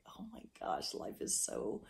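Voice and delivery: soft, half-whispered wonder; hushed awe. Retold or imitated. Woman to herself on remembering the dream, hushed amazement. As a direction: drop to an awed near-whisper. Voicing her own awed realization.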